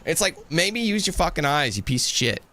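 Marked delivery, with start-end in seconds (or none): none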